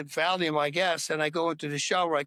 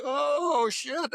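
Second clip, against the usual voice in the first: shocked; 8 semitones higher than usual. Retold or imitated. Discovering his own wound, retold.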